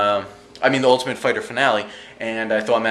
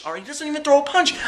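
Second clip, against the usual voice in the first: exasperated; faster than usual. Real, not acted. Exasperated aside after yelling.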